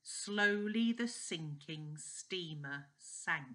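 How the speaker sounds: slow, careful "Slowly the sinking steamer sank". Shown as a demonstration. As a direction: take it slowly again. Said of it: "Let's try that again".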